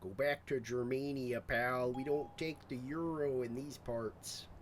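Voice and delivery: a loud, hammed-up Canadian accent, slow on the last line; mock Canadian accent. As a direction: play a pushy Canadian local telling a stranger off. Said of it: "I don't know why I became Canadian".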